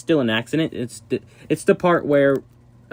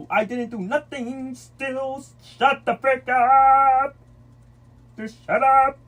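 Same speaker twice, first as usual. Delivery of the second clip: high loud scolding; 10 semitones higher, 4 dB louder, much slower than usual. Real, not acted. Yelling at fighting kids.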